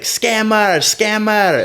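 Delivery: very high imitation Thai accent; mock Thai accent. Imitating an Australian calling a cab driver scammer, mock Thai accent. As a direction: mimic a put-on Thai accent, pitched right up. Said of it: "saying it with the Thai, with the Thai, um, accent".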